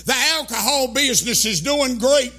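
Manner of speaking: loud, driving sermon cadence; fiery preaching. Acted. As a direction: preach it hard to the congregation. Preaching at full force.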